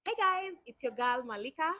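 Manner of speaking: ordinary talk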